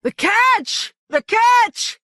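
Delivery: very high howl of loss. A narrator's reading. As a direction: wail over the ruined fish. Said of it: "Skebel was howling".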